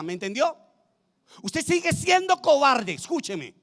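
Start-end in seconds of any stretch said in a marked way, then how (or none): 1.4-2.9 high, slow, emphatic pulpit cry; fervent preaching. Real, not acted. Preacher confronting the cowardly listener, emphatic. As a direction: stretch it out high to the congregation.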